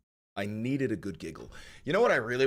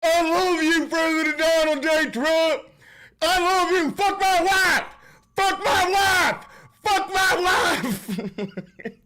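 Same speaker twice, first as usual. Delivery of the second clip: mock fan scream; 10 dB louder than usual. Streamer mocks a superfan, screaming high and loud.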